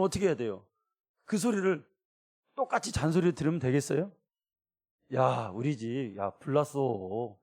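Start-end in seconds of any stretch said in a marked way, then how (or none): 5.1-7.4 slow, flat and a bit soft, no urgency; flat nagging tone. Shown as a demonstration. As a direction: say it like routine nagging, dragged out. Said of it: "이렇게 들어야 돼요?".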